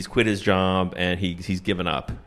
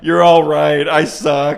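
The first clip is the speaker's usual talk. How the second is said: higher, louder cry of defeat; mock breakdown; 6 semitones higher, 8 dB louder, slower than usual. Father giving in on the stand, mock breakdown. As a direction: concede it as a hammed-up collapse. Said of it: "He gets him to break down".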